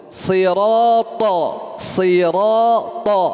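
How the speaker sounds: sirata slowed, heavy back vowels; thick ta. Shown as a demonstration. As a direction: say sirata slowly with a full thick ta. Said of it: "harus tebal". (sirata pelan dengan ta tebal)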